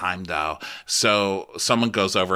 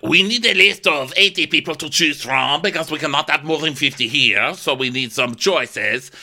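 bossy manager; 4 semitones higher, 4 dB louder than usual. Retold or imitated. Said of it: "And Marsha's like".

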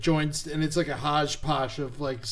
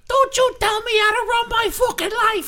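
very high, louder Jersey shriek; high-pitched Jersey rant; 5 dB louder than usual. Retold or imitated. Doing the high-pitched Jersey women that everyone loves, shrieking. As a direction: shriek it like a furious Jersey woman. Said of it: "I always love the high-pitched ones".

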